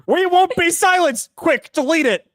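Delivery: high, sarcastic mock panic. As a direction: parody the hypocrisy. Mocking the developers' hypocrisy.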